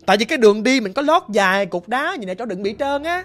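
ordinary talk, nothing marked

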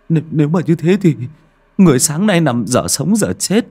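high, loud, frightened stammer; trembling with fear. A narrator's reading. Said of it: "Ông Chiến run lẩy bẩy".

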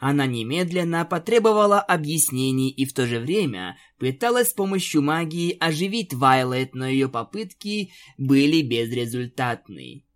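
plain reading voice

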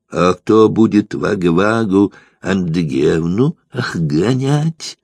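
burred French r, "Вагу-Вагу Андыгевну охганять" with throaty r; reproachful, "охганять" with a guttural burr for r; says w for r. A narrator's reading. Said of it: "укоризненно прокартавил Девре".

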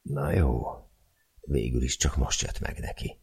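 ordinary delivery

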